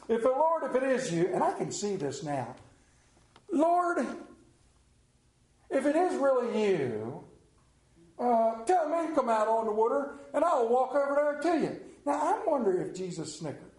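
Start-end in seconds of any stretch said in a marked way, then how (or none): 0.1-11.8 higher, pleading Peter, stretching out Lord; eager Peter. Acted. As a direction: call out to the Lord like brash Peter. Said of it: "And Peter goes".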